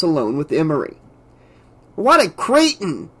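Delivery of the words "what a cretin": high-pitched indignant outburst, not very loud; held-back yell. A narrator's reading. Assistant insults the departed pilot, restrained indignant yell. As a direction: yell it without quite daring full volume. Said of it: "Emery yelled, but not quite loud enough".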